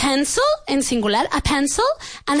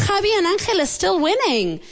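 usual speaking voice

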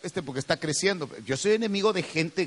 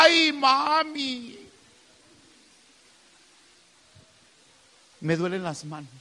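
put-on whiny child; 6 semitones higher, slower than usual. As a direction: play the complaining kid stretching "Ay, mami". Child whines to mother.

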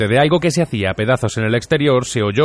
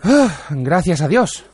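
slow, high sigh of relief; relieved; 6 semitones higher, much slower than usual. A narrator's reading. Arthur calming down after the scare, relieved. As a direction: breathe out the relief, slowly. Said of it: "tranquilizándose".

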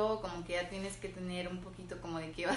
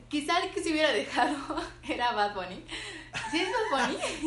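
laughing; 7 semitones higher, 4 dB louder than usual. Real, not acted. Laughing while recalling the name.

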